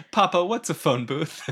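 put-on high, loud, laughing little kid asking "Papa, what's a phone booth?"; child voice. Cohost teasing about outdated phone booths in a laughing child voice. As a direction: play a puzzled kid teasing an old man.

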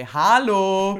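a German calling you out; scolding German "Hallo". Retold or imitated. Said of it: "a German will say".

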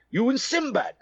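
high South African query; South African accent. Retold or imitated. Stranger asking about the actor's show, South African accent. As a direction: put on a South African accent, pitched well up. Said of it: "with a South African accent".

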